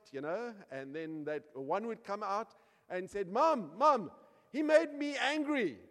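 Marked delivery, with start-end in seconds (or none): 3.3-5.8 high, loud complaint to Mom; hammed-up kid running to tell on a brother; tattling child. Voices quarrelling son complaining to mother.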